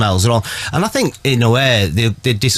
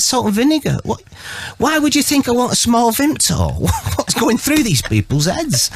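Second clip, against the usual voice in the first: incredulous; 11 semitones higher than usual. Retold or imitated. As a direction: squeal in disbelief at the mix-up. Incredulous retort to friend.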